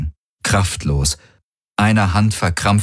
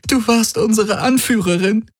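high-pitched grieving reproach; wounded, plaintive complaint; lamenting. A narrator's reading. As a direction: accuse through heartbreak. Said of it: "klagte sie".

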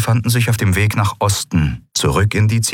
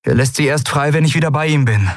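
high, lightly voiced heroine; calm young woman's explanation; explaining Endriel; 8 semitones higher than usual. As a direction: explain it plainly to the group.

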